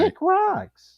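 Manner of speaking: high falsetto brush-off; mock-indignant squeak; scornful dismissal.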